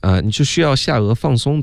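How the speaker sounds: ordinary talk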